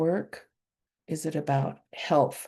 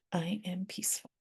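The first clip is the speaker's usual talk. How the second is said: whispered affirmation; 2 semitones higher, 10 dB quieter than usual. Real, not acted. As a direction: whisper it to yourself.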